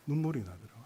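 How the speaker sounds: ordinary talk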